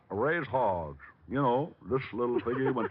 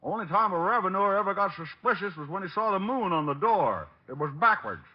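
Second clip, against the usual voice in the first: hillbilly put-on; 4 semitones higher than usual. Detective in disguise calling the psychic, country accent. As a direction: play the hog farmer yokel.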